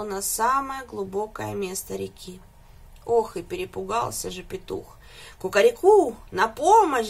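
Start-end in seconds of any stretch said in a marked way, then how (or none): 5.4-7.1 much louder frantic cry for help; panicked crowing. A narrator's reading. Frightened rooster crows for help, loud and frantic. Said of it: "закричал он не своим голосом".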